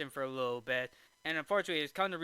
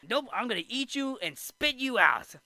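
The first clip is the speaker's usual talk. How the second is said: lightly voiced clam, pitched higher; a mild, higher mock refusal; mock clam threat; 7 semitones higher than usual. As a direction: play at being a threatening clam. The clam's threat, acted.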